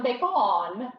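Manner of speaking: "bacon" as bay-CON, full vowel in the last syllable; fancy pronunciation. Shown as a demonstration. Ordering at brunch, fancy "bacon" with a stretched "-on". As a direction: draw out the end of "bacon" like "on". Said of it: "unless you're fancy, maybe you say it".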